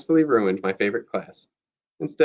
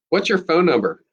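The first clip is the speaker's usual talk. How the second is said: higher, mild narrated scream; 6 semitones higher, 3 dB louder than usual. A narrator's reading. Lucy demands the classmate's number, mild scream. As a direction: demand it with pitch raised, slightly louder. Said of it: "I scream".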